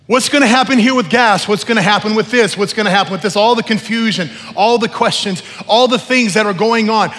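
fervent, loud call to surrender; impassioned preaching. Real, not acted. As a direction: preach it at full force, phrase by phrase. Preaches at full force.

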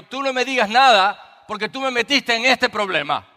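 high, exasperated retort at his daughter; panicked snap. Retold or imitated. Scared dad snaps at daughter who dragged him on, high exasperated retort. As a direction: blame her, half-panicked. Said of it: "Y le digo".

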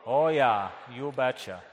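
hammed-up Wisconsin mother-in-law; low Wisconsin "oh yah"; Midwestern accent. Shown as a demonstration. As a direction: lead the crowd in broad Midwestern. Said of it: "get your Midwestern accents out".

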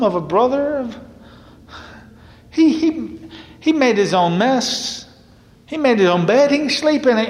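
high, whining, indignant protest; a resentful son railing at his father; bitter complaint. Acted. Older brother protesting to his father over the party, high and whiny.

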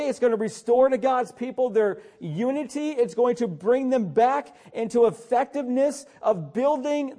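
loud, driving sermon delivery with hard-hit words; full-force preaching. Acted. Pastor urging his congregation, preaching at full force.

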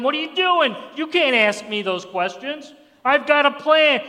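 high, loud mock scolding; mock yelling. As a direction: yell as if indignantly rebuking someone. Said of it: "Never yells at him".

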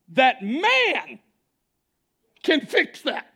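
loud, high preaching; preaching at full force. Real, not acted. Preacher rising to full force over the crowd. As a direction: drive the point home to the congregation.

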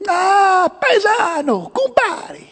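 high-pitched, hammed-up Italian waiter; playful mock Italian accent. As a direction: greet old pals as an effusive Italian headwaiter. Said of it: "in his Italian accent".

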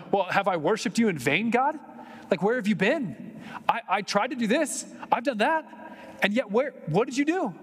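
exasperated plea, voice rising to a peak; Asaph's frustrated complaint. Retold or imitated.

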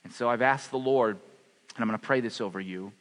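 ordinary talk

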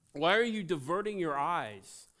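ordinary delivery

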